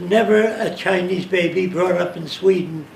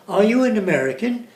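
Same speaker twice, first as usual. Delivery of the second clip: imitating an English accent, higher and lilting; sing-song English question; a touch of English lilt; 5 semitones higher, 3 dB louder than usual. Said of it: "with a certain sing-song".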